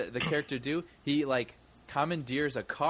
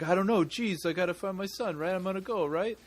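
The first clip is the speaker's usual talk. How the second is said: Kevin James; 5 semitones higher than usual. Retold or imitated. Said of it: "Kevin James just like".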